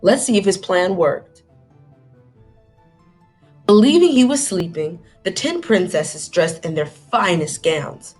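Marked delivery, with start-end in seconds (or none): none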